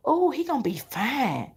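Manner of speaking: hushed daydream; inner thought. Retold or imitated. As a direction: muse it quietly to yourself. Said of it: "I'm saying in my head".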